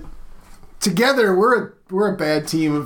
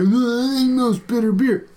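higher, slow put-on voice; mocking bitter-beer boaster; 5 semitones higher, slower than usual. Host mocking brewers who compete over bitterness, derisive put-on voice. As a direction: drag out the mock boast. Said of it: "why is that cool? Like".

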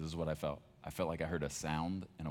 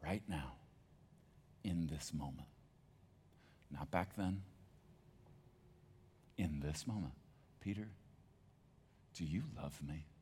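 softer, gentle and unhurried; tender question; 7 dB quieter than usual. Acted. Jesus asking Peter over breakfast, soft and tender. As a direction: ask it softly, like forgiving a friend. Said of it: "He says".

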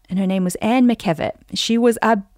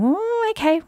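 mock puzzled reaction; 12 semitones higher than usual. Listener wondering about the retirement plan, mock puzzled. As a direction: voice a doubtful listener, pitched way up.

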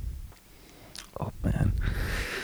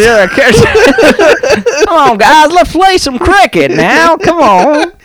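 much higher, laughing exaggerated Indian accent; mock Indian accent. Bullies taunting him, laughing mock Indian accent. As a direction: hurl insults through laughter in an Indian accent. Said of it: "bullied in an Indian accent".

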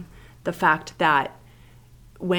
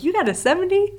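mock celebration; 9 semitones higher, 10 dB louder than usual. Mom celebrating a low grade.

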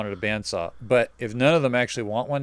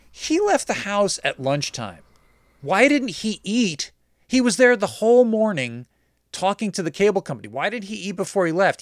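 exasperated; 7 semitones higher than usual. Real, not acted. Exasperated rant.

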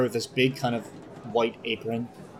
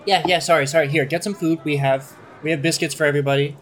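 hurried, higher apologetic voice; flustered cook; 5 semitones higher, 5 dB louder, faster than usual. Acted. Cook placates a grumpy customer, flustered and higher. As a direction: apologize in a fluster and press food on them.

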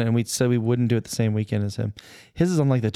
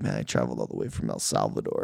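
weary migrant; 6 dB quieter than usual. Pretend. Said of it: "And I'll be like".

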